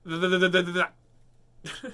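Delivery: a quick, loud run of repeated words; rapid-fire repetition. Shown as a demonstration. Reading to kids, tries a tongue twister fast. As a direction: rattle off that as fast as possible. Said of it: "counting gets in the way of the speed".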